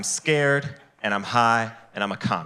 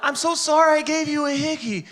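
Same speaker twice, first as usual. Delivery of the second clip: put-on much higher, sweet apologetic girl. Acted quote of hickey girl.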